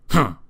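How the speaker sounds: short, cross "humph"; grumpy grunt. A narrator's reading. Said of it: "grunted Mister Grumpy".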